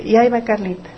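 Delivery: ordinary talk, nothing marked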